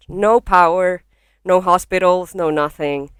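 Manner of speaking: ordinary talk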